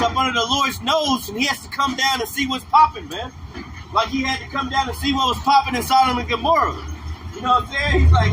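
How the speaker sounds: usual speaking voice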